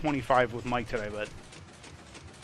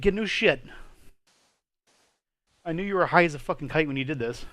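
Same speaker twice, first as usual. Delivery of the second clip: ordinary delivery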